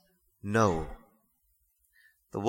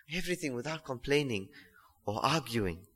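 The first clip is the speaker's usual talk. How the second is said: verse repeated much softer, almost to himself; hushed echo of the verse; 10 dB quieter than usual. Shown as a demonstration. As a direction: let it sink in, quietly. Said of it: "repeats verse much softer".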